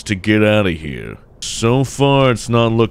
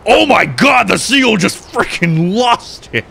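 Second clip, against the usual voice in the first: shocked outburst; 10 semitones higher than usual. Real, not acted. Player watching the seal lose it, shocked outburst. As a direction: shout it in astonishment. Reacting to a game surprise.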